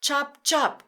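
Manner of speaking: high, loud chop chop; helicopter chop. Shown as a demonstration. Teacher making helicopter sound, loud. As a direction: chop like rotor blades. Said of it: "your turn to make the sound".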